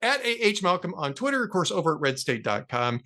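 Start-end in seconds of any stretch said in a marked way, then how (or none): 0.0-1.4 high, punched-up showman's introduction; announcer billing. Pretend. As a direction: hail the guest like a ring announcer. Host bills guest at sign-off.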